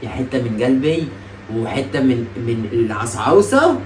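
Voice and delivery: low, soft, slow coaxing; sweet-talking. Real, not acted. Prankster winning back his angry partner, sweet-talking. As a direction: coax tenderly, drawing the words out.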